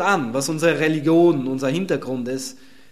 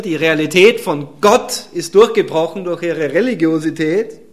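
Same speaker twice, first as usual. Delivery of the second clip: usual speaking voice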